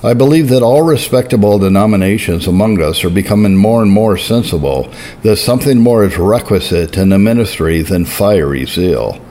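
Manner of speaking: usual speaking voice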